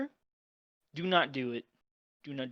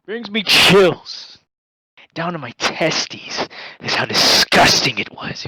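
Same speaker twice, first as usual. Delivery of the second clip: loud, slow and drawn out in disgust; 12 dB louder, slower than usual. Real, not acted. Telling the host about the foul water, loud and slow. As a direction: stretch each word, loud and revolted.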